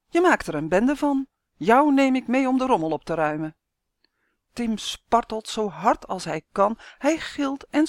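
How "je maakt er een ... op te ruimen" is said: higher, louder stern scolding; angry scolding. A narrator's reading. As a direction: keep scolding the boy. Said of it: "roept hij boos". (boze buurman die scheldt)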